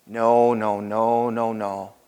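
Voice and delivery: low, soft string of noes; hushed dismissal. Real, not acted. Preacher answering that Christians sound wimpy, hushed dismissal. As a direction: drop right down in pitch and volume.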